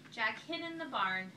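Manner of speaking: usual speaking voice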